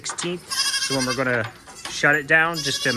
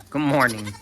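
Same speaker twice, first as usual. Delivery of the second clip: ordinary talk, nothing marked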